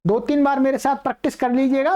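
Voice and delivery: usual speaking voice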